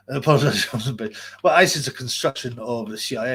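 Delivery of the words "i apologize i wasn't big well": normal talking voice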